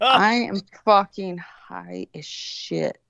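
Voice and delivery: very slow, drawn-out stoned voice; stoned confession. Retold or imitated. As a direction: stretch each word out as if time had slowed. Retold stoned confession to stranger.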